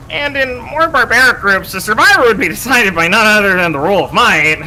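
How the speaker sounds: arrogant, measured villain voice; cold scientist villain. Acted. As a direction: read it like a smug scientist. Continues villain dialogue.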